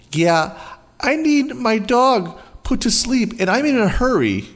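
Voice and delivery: high, pushy demanding mom voice; voiced entitled mother, impatient. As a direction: play the rude customer in a hurry.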